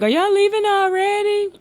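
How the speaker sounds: mimicking the bartender. Retelling the bartender asking if they're leaving, high mock voice. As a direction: squeak it out like a startled bartender. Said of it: "The bartender was like".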